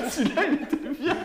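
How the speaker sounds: high, loud laughing words. Real, not acted. Host still laughing at the guessing game. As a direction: giggle through it. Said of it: "laughing with friends".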